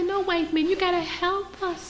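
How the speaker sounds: a hint of helpless pleading; mock pleading. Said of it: "We go and say".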